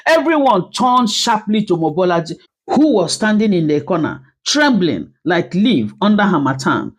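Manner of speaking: ordinary delivery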